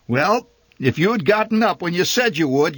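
arguing mother. A narrator's reading. Mother argues back.

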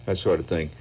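ordinary talk, nothing marked

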